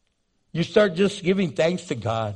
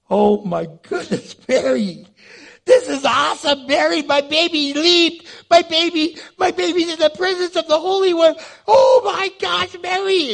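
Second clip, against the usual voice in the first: much higher, louder, gushing excitement; overjoyed; 11 semitones higher, 4 dB louder than usual. Retold or imitated. Elizabeth gushing to Mary about her leaping baby. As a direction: bubble over with joy.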